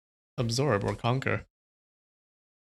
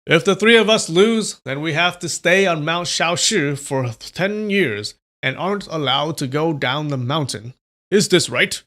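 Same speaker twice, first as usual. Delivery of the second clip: forceful demand; 5 semitones higher, 5 dB louder than usual. Acted. Villain challenges loudly.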